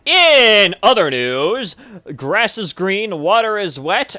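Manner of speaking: slow, loud mock news anchor; deadpan newsreader delivering the obvious. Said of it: "More news at eleven".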